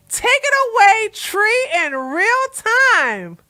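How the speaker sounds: high, slow, stretched-out announcer call; mock announcer handoff. Host handing over to himself in real time, announcer-style. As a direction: ham up a big handoff with drawn-out words.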